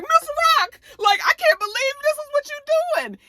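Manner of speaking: very high, squealing wail; comic panicked crybaby squeal; mock tearful shock. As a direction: wail it in hammed-up disbelief. Acts Fame shocked by the gun.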